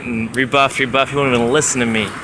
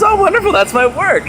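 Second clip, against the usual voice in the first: much higher and louder, gushing; mock delighted; 6 dB louder than usual. The teacher hearing about the books, high and gushing. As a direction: gush like a pleased teacher. Quoting Indra.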